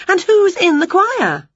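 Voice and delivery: high, lightly voiced witch question; inquisitive witch. A narrator's reading. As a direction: ask high, as a nosy storybook witch. Winnie questioning the conductor.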